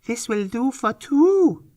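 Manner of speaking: sly pleased little creature. Acted. Brownstone's creature voice.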